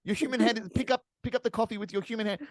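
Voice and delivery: high-pitched, incredulous berating; exasperated mock scolding. Podcaster berating a coffee-drinking film character, exasperated. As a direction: plead with the character in comic exasperation.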